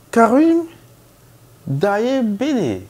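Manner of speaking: high, loud call across the waiting room; calling out a name. Retold or imitated. Nurse loudly calling the patient's mangled name.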